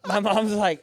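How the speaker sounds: ordinary delivery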